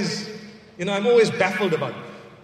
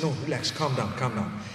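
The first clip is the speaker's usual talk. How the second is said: soothing; 8 semitones lower, 6 dB quieter than usual. Retold or imitated. Calming the apologising woman.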